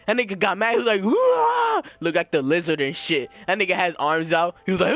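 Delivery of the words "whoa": high drawn-out "whoa" scream; high-pitched mock battle cry; imitated scream. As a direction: mimic someone screaming at the sky. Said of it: "screaming in the sky and shit".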